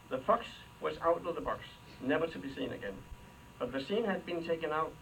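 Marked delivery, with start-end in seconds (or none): none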